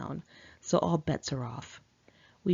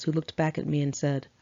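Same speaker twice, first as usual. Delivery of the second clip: ordinary delivery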